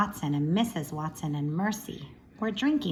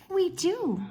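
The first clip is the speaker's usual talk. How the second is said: much higher, meek little sister; 10 semitones higher than usual. Acted. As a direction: pipe up high like a meek younger sister. Baby voiced in read-aloud.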